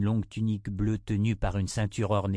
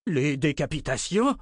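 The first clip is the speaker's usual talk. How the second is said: higher, incredulous exclamation; shocked cry; 5 semitones higher than usual. A narrator's reading. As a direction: echo it back in disbelief. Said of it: "s'écria le chancelier". (exclamation incrédule et choquée)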